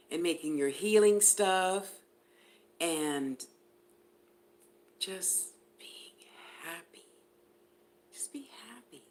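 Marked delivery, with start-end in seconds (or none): 5.0-9.0 breathy whisper, no voice at all. Real, not acted. Reader whispering advice to her client. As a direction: let it drop to a hushed secret.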